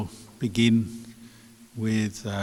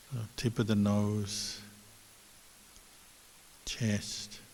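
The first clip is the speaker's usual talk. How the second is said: soft, unhurried meditation guidance with long pauses; guided meditation; 6 dB quieter than usual. Real, not acted. Teacher guiding online listeners to watch the breath, quiet meditation voice. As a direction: lead them gently into stillness, leaving space between phrases.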